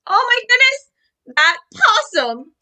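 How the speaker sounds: ordinary delivery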